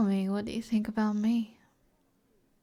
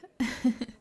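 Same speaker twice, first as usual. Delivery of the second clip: a short, flattered giggle; giggle, charmed by the offer; light laugh; 3 semitones higher than usual. Acted.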